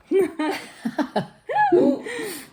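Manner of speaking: high burst of laughter. Real, not acted. Straw mishap while practicing.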